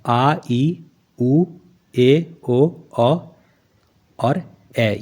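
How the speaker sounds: each long vowel आ, ई, उ said separately and clearly; reciting long vowels. Shown as a demonstration. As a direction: chant the long vowels one by one like a teacher. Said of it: "कौन कौन से हैं?".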